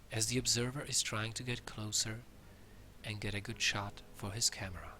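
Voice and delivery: hushed wildlife narrator whisper; nature documentary. Pretend. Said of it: "because National Geographic pays millions".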